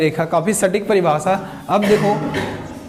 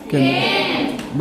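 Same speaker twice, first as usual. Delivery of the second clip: breathy, slow, stretched-out केंद्र; drawn-out whispered answer; 5 semitones lower, much slower than usual. Shown as a demonstration. Teacher gives class the answer. (धीमे, फुसफुसाकर खींचा हुआ जवाब)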